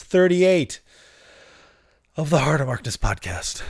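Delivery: high, loud scream to the heavens; triumphant scream. Real, not acted. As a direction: scream the number with full pride. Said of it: "I scream it to the heavens".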